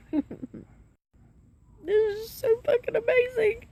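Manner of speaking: high, teary voice breaking on the words; tearful joy. Real, not acted. To her viewers about recovery, crying happy tears. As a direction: sob it out with relief. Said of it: "I started crying on y'all".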